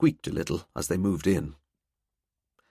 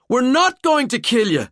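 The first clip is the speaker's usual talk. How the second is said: high, loud shout over screaming; 7 dB louder than usual. A narrator's reading. Valkyrie shouting over the terrified pair's screams. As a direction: yell to cut through the panic. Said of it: "Valkyrie shouted".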